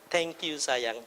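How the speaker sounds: very soft, low loving thanks; tender. Pretend. Husband thanking wife for coffee, very soft and low. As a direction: murmur it tenderly to your wife. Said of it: "belajar bilang begini".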